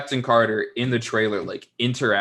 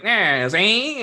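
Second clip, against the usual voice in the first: imitating a showy Italian-American accent, higher and louder; mock Italian-American accent; 10 semitones higher, 6 dB louder than usual. Mocking the show's characters, a mock Italian-American accent. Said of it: "everyone's like".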